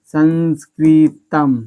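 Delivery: संस्कृतम slowly, ending on म; final m sound. Shown as a demonstration. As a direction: stretch संस्कृतम and land on म. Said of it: "क्या आ गया उच्चारण".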